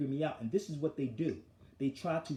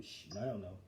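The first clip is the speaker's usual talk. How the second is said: mock hushed evasion, much softer; hushed dodge; 9 semitones lower, 10 dB quieter than usual. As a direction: drop to a hushed, secretive near-whisper. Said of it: "this is what they do".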